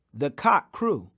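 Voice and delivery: ordinary delivery, nothing marked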